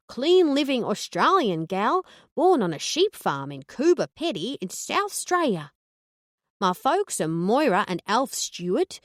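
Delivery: cheery over-the-top Aussie gal; Aussie accent. Acted. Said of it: "in the best Aussie accent I could muster".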